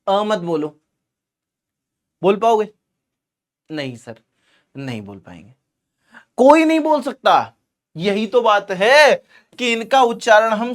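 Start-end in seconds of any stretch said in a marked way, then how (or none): 3.7-5.5 low, hushed reply as the class; students giving up. Acted. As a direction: answer quietly and meekly for the students. Teacher voices students giving up. (छात्रों की ओर से धीमी, दबी आवाज़ में हार मानते हुए)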